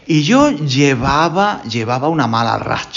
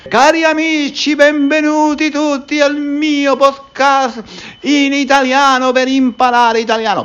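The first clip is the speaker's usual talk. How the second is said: pretend Italian teacher; 11 semitones higher than usual. Spanish teacher pretending to be an Italian teacher welcoming listeners, theatrical Italian. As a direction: play an over-the-top Italian podcast host. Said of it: "profesor de italiano, hablaría en italiano".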